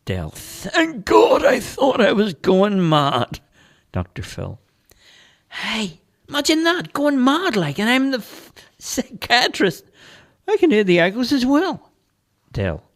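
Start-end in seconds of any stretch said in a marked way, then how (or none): 0.3-3.4 much higher, louder and slower; relieved shout. Acted. Dale relieved the priest hears it too, shouting. 5.5-11.9 high, louder, amused doctor; psychiatrist's voice. Acted. Psychiatrist admitting he hears echoes too, high amused voice.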